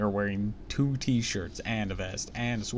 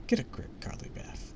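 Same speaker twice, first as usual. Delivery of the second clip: whispering; 7 semitones higher, 6 dB quieter than usual. Acted. Telling herself off inwardly.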